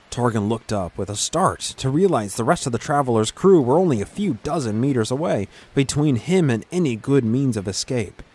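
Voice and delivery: ordinary delivery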